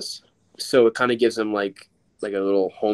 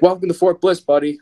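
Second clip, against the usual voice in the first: higher, louder cheerful cop; pitched-up friendly cop welcome; imitating the officer; 7 semitones higher, 5 dB louder than usual. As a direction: greet him like a beaming officer.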